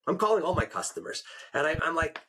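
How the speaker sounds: ordinary talk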